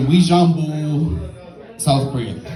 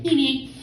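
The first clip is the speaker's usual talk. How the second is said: short high-pitched beeps; imitated Korean siren; 12 semitones higher, 5 dB quieter than usual. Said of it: "in Korea, it's more like".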